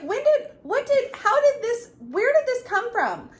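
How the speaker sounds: high, loud tumbling questions; bewildered questions. Retold or imitated. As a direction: fire off confused questions in shock. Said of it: "And I was like".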